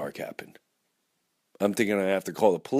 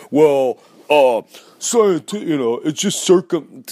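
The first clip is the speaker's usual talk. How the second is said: mocking know-it-all; 3 semitones higher, 9 dB louder, slower than usual. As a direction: ham up a flustered know-it-all fumbling the science. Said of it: "you can scientifically say".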